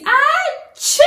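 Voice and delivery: loud high mock sneeze; a big hammed-up achoo; fake sneeze. Shown as a demonstration. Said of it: "or if you sneeze".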